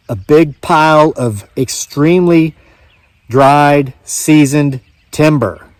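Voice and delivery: ordinary delivery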